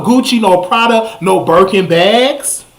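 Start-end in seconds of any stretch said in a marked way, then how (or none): none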